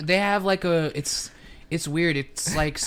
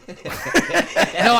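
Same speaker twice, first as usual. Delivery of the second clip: laughing; 9 semitones higher, 6 dB louder than usual. Real, not acted.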